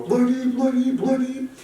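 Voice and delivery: loud high-pitched taunting chant; mock screaming. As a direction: squawk it repeatedly to jeer. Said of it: "just gonna scream your name".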